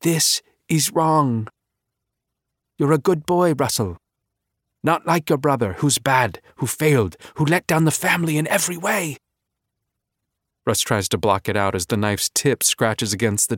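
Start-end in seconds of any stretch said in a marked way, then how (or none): 0.0-9.2 whispering mother's voice. A narrator's reading. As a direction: whisper it like a mother's voice in his head. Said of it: "whispers things he already knows".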